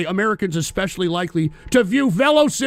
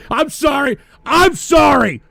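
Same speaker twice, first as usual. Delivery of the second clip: laughing apology; 5 semitones higher, 5 dB louder than usual. Real, not acted. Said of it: "I just cursed out this guy".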